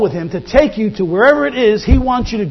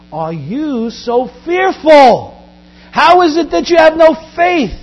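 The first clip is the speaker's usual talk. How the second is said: very slow, then higher, louder scolding; stern rebuke; 6 semitones higher, 5 dB louder, slower than usual. Acted. Jesus scolding disciples after the calm: slow, loud rebuke. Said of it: "he said to them".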